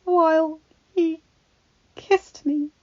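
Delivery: high, halting tearful voice; near tears. A narrator's reading. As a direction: fight back tears on the words. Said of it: "new tears threatened to spill over".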